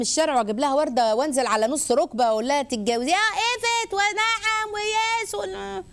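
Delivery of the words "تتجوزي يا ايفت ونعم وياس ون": high, drawn-out put-on swooning voice; mock proposal. Mocking a couple's public proposal in the street, hammed-up gushing. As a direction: ham up a gushing marriage proposal, slowly.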